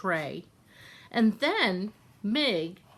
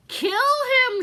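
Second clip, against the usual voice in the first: very high, loud barked command; shouted order; 7 dB louder than usual. Acted. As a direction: order the kill at full volume. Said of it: "shouted Cook".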